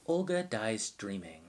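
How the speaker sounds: slow, measured mock audiobook read; deliberate, overly careful narration; audiobook narrator voice. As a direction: parody a commercial fiction narrator, pausing after each phrase. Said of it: "audiobook narrator voice is always like".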